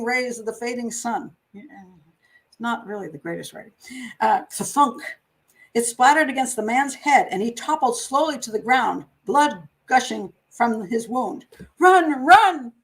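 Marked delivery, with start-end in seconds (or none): 4.5-5.0 a mock thud noise; thunk sound. Bottle hits the head. 11.8-12.7 high, loud urgent cry; alarmed shout. Retold or imitated. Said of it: "I shouted in alarm".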